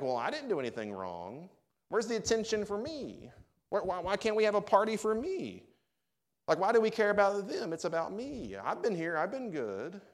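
high, whiny, mock-petulant complaining; whiny self-pity. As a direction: play the spoiled sulking child, pitched high. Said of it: "We're like".

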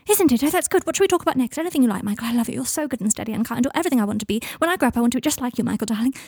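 high, loud start easing into adoration; manic gushing. Acted. Draco flattering Michael mid-quarrel, manic and gushing. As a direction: gush without stopping for breath.

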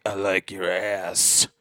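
slow, leering come-on; a man leaning in to whisper a crude line, slow and leering; sleazy creep voice. Retold or imitated. Creep leans in, says line.

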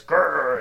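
loud mock growl; dog growl. Retelling Math Dog's bite, mock growl. Imitates dog attacking, biting.

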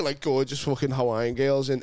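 ordinary talk, nothing marked

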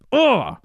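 loud, high exhale of hype; big fired-up "ugh"; hyped grunt. Real, not acted. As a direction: let out a pumped-up grunt. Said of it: "That was hyped".

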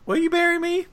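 lightly acted high-pitched proposal; Felicity. Felicity crashing the wedding to propose, high-pitched imitation. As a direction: pitch the question way up, mock pleading. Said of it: "crashes their, their wedding and says".

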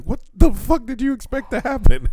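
high, loud exasperated yell; retold exasperation. Retelling his outburst at the match, loud exasperated yell. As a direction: blow up in mock disbelief. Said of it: "And I was like".

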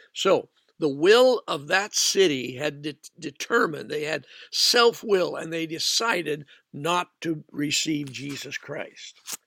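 usual speaking voice

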